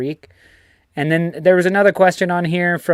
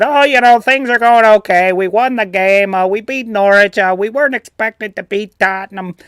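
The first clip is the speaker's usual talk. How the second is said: louder, higher mock Chicago accent; 5 semitones higher, 4 dB louder than usual. Previewing the match as a local fan, Chicago accent. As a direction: put on a chirpy-pitched, loud Chicago fan. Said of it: "he's not from Chicago".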